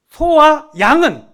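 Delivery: lingering on the cattle and sheep; drawn-out emphasis. Acted. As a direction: stretch out Pharaoh's condition slowly. Pharaoh's condition, drawn out.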